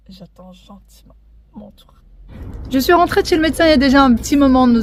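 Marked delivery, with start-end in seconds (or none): 0.1-1.9 soft waiting-room whisper. Real, not acted. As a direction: whisper so others can't hear. Waiting in the doctor's office.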